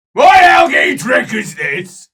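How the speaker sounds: loud, high, slowing accusing bellow; dwarf accusing the elves of tricks; suspicious dwarf shout. Acted.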